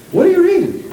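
mock nosy question. Retold question, repeated higher.

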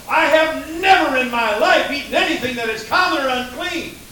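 high, loud, fervent protest of never; Peter protesting. Acted. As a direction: cry out Peter's indignant refusal. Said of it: "Peter said".

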